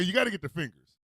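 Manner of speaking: ordinary delivery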